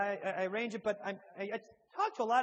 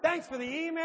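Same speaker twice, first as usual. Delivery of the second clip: excited greeting; 7 semitones higher, slower than usual. Real, not acted. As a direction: shout their names with relieved delight. Joyful greeting after finally finding hosts.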